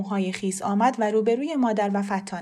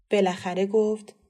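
plain reading voice

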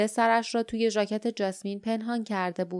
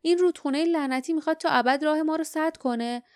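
higher-pitched, exasperated outburst; an acted burst of frustration, voice raised in pitch; angry; 6 semitones higher than usual. A narrator's reading. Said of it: "جاسمین با عصبانیت گفت". (با عصبانیت و کلافگی)

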